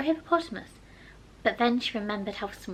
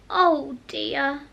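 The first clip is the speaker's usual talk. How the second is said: sorrowful; 3 semitones higher, 3 dB louder than usual. A narrator's reading. Alice sorrows as the mouse swims off, dismayed. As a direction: sigh it out in dismay. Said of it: "in a sorrowful tone".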